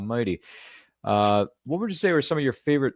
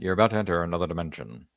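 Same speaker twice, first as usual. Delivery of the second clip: a hint of a lowered, ominous TV narrator; lightly voiced eerie anthology host opening; Rod Sterling intro; 4 semitones lower, faster than usual. Host quoting the Twilight Zone opening to his guest, lightly voiced ominous narrator. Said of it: "Rod Sterling".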